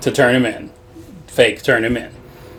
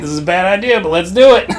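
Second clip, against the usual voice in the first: laughing quote; 6 semitones higher, faster than usual. Retold or imitated. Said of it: "she's like".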